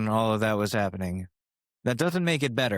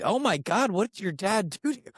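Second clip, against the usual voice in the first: higher, mock-horrified gasp of a question; horrified listeners; 8 semitones higher than usual. People hearing his hot tub story, voiced high and horrified. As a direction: act out their shock. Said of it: "they're like".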